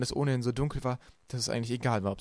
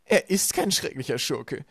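loud, slow, higher-pitched retort; villainess contradicting; 4 semitones higher, 7 dB louder, slower than usual. A narrator's reading. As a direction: contradict them slowly and loudly. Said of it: "widersprach Esme". (laut und gedehnt widersprechend)

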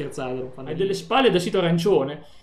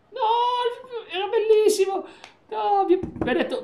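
laughing. Real, not acted. Laughing while recounting a call.

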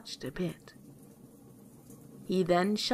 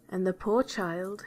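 ordinary delivery

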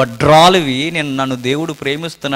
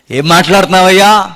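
indignant retort; 6 semitones higher, 8 dB louder than usual. Retold or imitated. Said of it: "ఆ రోజు ఏమంటది".

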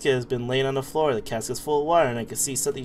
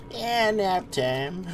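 put-on sleepy old person voice; 6 semitones higher, much slower than usual.

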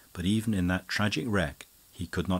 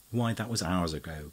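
ordinary delivery

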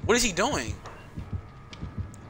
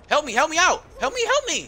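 urgent plea; 9 semitones higher, 5 dB louder than usual. Real, not acted. Begging teammates for help.

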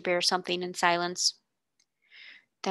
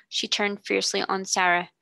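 usual speaking voice

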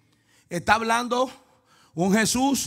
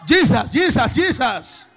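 fervent calling out; 3 semitones higher, 5 dB louder, much slower than usual. Real, not acted. Praise after the climax.

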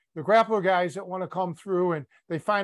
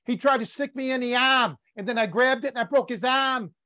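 higher-pitched teasing imitation of a Boston accent; mock Boston accent; 4 semitones higher than usual. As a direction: mimic the Boston accent, dropping the R in "arm". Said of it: "you said a couple of foreign words".

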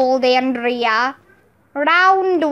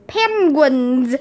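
ordinary delivery, nothing marked

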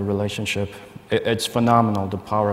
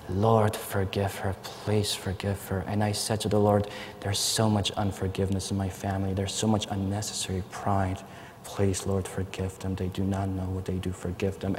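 quiet prayer; 7 dB quieter than usual. Retold or imitated. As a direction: murmur it to God, begging forgiveness for family. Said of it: "I'm saying to him".

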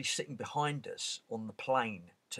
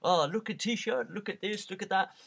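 much higher, loud eager hawker call; Egyptian street seller; 10 semitones higher, 8 dB louder than usual. Retold or imitated. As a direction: beckon a tourist in like a pushy market trader. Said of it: "they'd be like".

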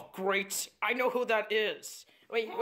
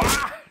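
loud, sudden pained cry; yelp of pain; 3 semitones higher, 7 dB louder than usual. Acted. Hit after telling the brother to stop, pained yelp. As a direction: yelp as if struck.

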